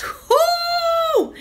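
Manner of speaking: long high-pitched whoo shout; exasperated whooping cry. Real, not acted. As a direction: let out a high wailing whoop. Remembering Denny's days.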